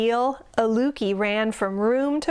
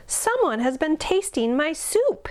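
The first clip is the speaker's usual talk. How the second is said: mild mock roar; 8 semitones higher than usual. A narrator's reading. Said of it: "he roared".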